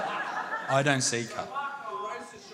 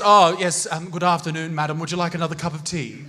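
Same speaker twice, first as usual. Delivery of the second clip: put-on polite old English accent; 3 semitones lower than usual. Early settler offering a madam tea, polite English accent. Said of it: "we were all speaking like this".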